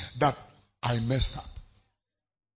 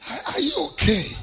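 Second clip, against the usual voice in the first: mock caring question; 12 semitones higher than usual. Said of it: "go like, uh".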